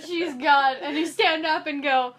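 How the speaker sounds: can barely get the words out for laughing; laughing while telling. Real, not acted. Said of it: "while crying".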